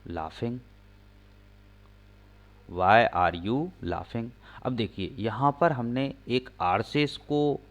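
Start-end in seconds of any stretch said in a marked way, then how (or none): 2.7-4.3 slow, drawn out; slow teaching read; slow full read. Shown as a demonstration. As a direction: read the answer slowly to the class. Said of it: "हंसने को क्या बोलते हैं?".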